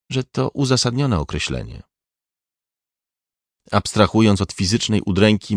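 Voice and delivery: usual speaking voice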